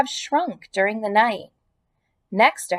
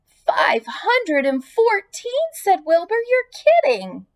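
very high, slow disbelief; astonished; 10 semitones higher, slower than usual. Acted. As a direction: gasp it out in amazement. Wilbur astonished.

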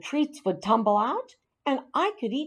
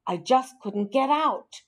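ordinary delivery, nothing marked

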